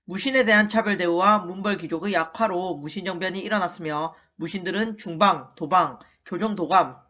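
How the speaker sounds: low, even-pitched textbook reading; read-aloud recitation. Shown as a demonstration. Teacher reads the lesson summary for the class to repeat, low measured recitation.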